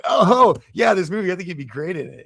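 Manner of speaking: put-on awkward high school kid. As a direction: gush it out like a dumbass kid who just hit puberty. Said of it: "like a dumbass high school kid who started puberty".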